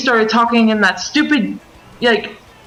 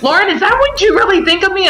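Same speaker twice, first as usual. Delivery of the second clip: higher, whiny mock plea; mock hurt whine; 10 semitones higher, faster than usual. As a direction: play him as wounded and pathetic. Mocking Gabe's hurt plea.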